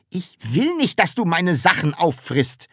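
high, indignant outcry; exasperated call. A narrator's reading. Taschenbier forbidding Sams to eat his things, exasperated. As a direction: protest sharply at the damage. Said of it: "rief der".

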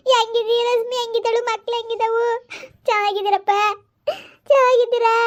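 high voice breaking into crying; tearful mother. Acted. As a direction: ask after the family through tears. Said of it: "ಅವ್ಳು ಬಿಡು ಸುಮ್ಕಿರು". (ಅಳುತ್ತಾ ವಿಚಾರಿಸುವ ತಾಯಿ)

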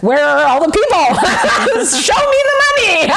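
high laughing cry of frustration; laughing shout. Retold or imitated. Retold frustrated outburst, laughing.

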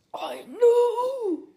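high cheeky puppet cry; squeaky ventriloquist dummy. Acted. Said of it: "I'm a ventriloquist".

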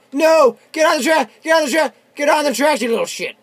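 loud, high, insistent yelling; exasperated shouting. Real, not acted. Gamer ordering the enemy onto the tracks, exasperated shouting. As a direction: yell the order over and over.

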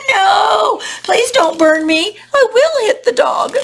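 high, loud pleading wail; hammed-up panic of a storybook stick; crying out. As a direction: beg loudly in a high voice. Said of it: "the stick in turn cried out".

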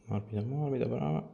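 soft, slow, hushed guidance; soothing breath coaching. Real, not acted. Therapist calming her patient on the table, soft and hushed. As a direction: ease her breathing along gently.

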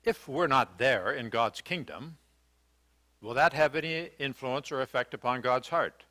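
ordinary talk, nothing marked

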